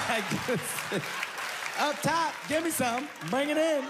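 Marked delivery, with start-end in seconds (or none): 1.8-3.8 high, bright mock praise; sheepish congratulations; awkward fake cheer.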